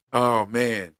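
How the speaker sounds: ordinary delivery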